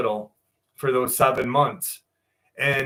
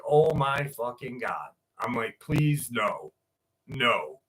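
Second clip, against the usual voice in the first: retold dismay; 4 semitones lower, 5 dB quieter than usual. Dismay at sounding like father.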